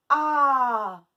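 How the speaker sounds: A very loud and held long; stretched vowel. Shown as a demonstration. Teacher letting pupils hear the A, stretched vowel. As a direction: sustain A as a strong open vowel. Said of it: "Où est-ce qu'on entend le A?".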